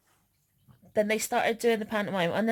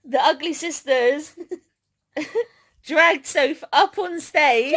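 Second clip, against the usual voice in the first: louder, slow, laughing through the words; 7 semitones higher, 6 dB louder, slower than usual. Real, not acted. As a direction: tell it while cracking up. Laughing retelling of being dragged up.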